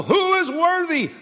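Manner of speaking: high, ringing angelic cry; mighty angel. Acted. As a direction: call it out like a mighty herald. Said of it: "and this mighty angel".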